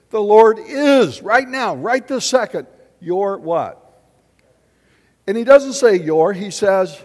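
loud, high, emphatic preaching; fervent pulpit proclamation. Acted. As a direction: preach it out with full force. Preaching at full force.

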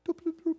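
high-pitched little "doop" bloops; cartoonish bobbing Adam's apple sound; tiny high blips, one after another; imitated gulping noise. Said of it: "Adam's apple went".

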